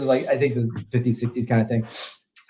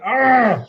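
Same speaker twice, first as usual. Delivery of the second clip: frustrated, higher-pitched "argh"; exasperated groan; 10 semitones higher, 3 dB louder than usual. Retold or imitated. Guest moaning about the overused Wilhelm scream, exasperated groan. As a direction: let out a mock groan of exasperation.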